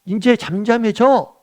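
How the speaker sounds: high, slow, firm word to the storm; one decisive command, stretched out; Jesus stilling the storm, acted high and slow; calm command. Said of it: "말씀 한번 딱 하는 거예요".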